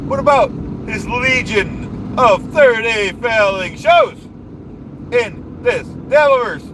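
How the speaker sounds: blustering rally-speech voice, big falling endings; pompous politician rant. Acted. Comic ranting at the audience about the dues payer, blustering politician voice. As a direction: rant like a self-important demagogue at a rally.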